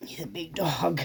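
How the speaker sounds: breathy whispered aside. Real, not acted. Owner whispering about her big dog, hushed. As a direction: whisper it like a secret.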